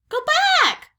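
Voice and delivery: very high, loud parrot screech; squawking parrot. A narrator's reading. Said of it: "squawked Polly".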